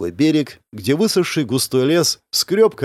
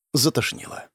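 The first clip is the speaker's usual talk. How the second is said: plain reading voice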